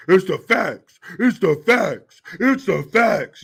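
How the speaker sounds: loud, insistent repeated shout, slowing down; hammed-up furious guest shouting the same line; mock angry yell. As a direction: bark it over and over, refusing to hear anything else. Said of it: "You yelled that shit three times in a row".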